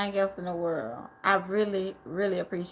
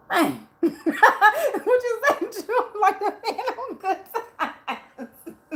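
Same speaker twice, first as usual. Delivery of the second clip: words squeezed through laughter; laughing; 11 semitones higher, 4 dB louder, much slower than usual. Real, not acted. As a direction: laugh out the line.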